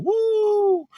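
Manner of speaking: high, joyful church whoop; a preacher's exultant woo; jubilant whoop. Real, not acted. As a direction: cry out in triumph. Exulting over scripture verse.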